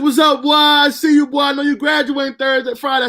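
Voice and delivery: high, loud happy call of his cousin; imitating the cousin's excited hello; cousin's greeting. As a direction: belt it out like the big cousin calling. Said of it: "he said".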